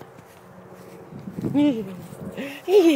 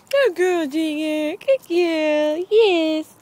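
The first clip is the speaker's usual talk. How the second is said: excited dog praise; 4 semitones higher, 6 dB louder, slower than usual. Real, not acted. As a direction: cheer the dog on loudly. Praising dogs, loud and high.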